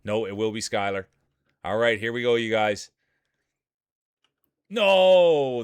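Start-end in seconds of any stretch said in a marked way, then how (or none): 4.7-5.6 sudden loud high cry of dismay; real shock as the leader crashes. Real, not acted. Commentator watching the leader get whacked, shocked shout.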